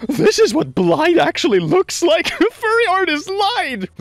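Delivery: shrill high mock outrage, slowed; high outraged exclaim. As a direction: gasp it out way up high.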